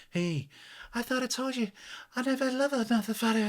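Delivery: high mock pop duet voice. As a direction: mimic the duet's spoken part. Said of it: "reminds me of [name] and [name]".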